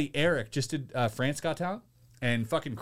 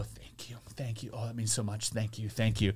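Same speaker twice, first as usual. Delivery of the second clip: soft, much quieter fake-gracious thank you; humbly thanking a judge, much quieter; mock humble thanks; 6 semitones lower, 7 dB quieter than usual. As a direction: gush thanks quietly and meekly. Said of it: "I'm gonna go".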